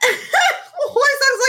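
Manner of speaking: high laughing burst; laughing shriek. Real, not acted. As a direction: crack up mid-word. Laughing at Huaisang's plight.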